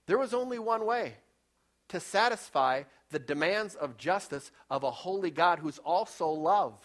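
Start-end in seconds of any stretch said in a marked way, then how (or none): none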